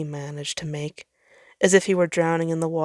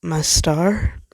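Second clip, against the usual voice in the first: worried; 4 dB louder than usual. A narrator's reading. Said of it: "Jupiter sounded worried".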